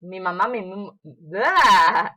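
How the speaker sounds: playful failed tongue twister; tongue-tied flub. Shown as a demonstration. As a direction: trip over "mim-" and blurt a loud "bleh". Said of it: "It's hard".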